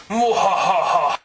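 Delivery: slow, loud villain laugh; evil cackle. Acted. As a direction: cackle like a cartoon baddie. Villain gloats after escaping.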